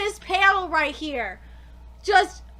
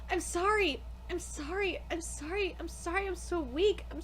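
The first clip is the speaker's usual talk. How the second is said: quiet apologetic; 5 semitones lower, 12 dB quieter than usual. Acted. Reads a character's apology lines.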